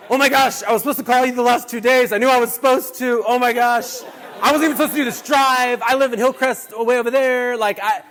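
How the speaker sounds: high, loud imitation of an overwhelmed driver; breathless-with-news retelling, shouted high; excited disbelief. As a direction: gush in amazement, acting her out at full volume. Retelling driver's amazed, clapping greeting.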